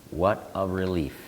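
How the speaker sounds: usual speaking voice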